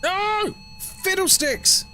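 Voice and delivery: high, slow mock-dismayed exclamation; dismayed cry. Real, not acted. Streamer reacting to a ghost-hunt setback in a high, slow, comic cry. Game ghost goes bad.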